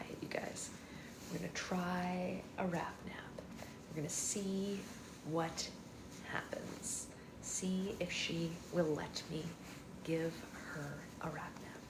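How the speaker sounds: soft unvoiced whisper; hushed aside to viewers. Real, not acted. Whispering to viewers, baby sleeping.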